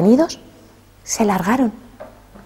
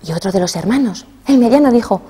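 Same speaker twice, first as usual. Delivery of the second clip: usual speaking voice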